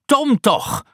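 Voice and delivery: very high, loud exasperated call. Acted. Aunt Polly calling for Tom, high exasperated call. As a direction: call him, losing patience.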